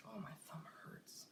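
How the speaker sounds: hushed pained whisper; a whispered ouch to yourself; whispered complaint. Real, not acted. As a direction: breathe it out under your breath. Pained aside while working.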